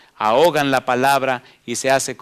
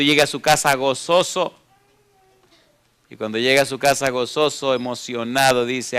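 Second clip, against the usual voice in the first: ordinary talk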